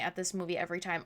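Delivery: normal talking voice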